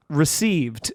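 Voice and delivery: low, slightly spaced-out acknowledgement; guy on mushrooms. Retold or imitated. Tripping comedian answering a fan's compliment, guy on mushrooms. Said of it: "like, guy on mushrooms".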